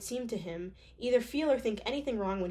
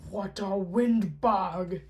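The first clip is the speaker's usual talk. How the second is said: grumpy prince; 6 dB louder, much slower than usual. Acted. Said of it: "he thought".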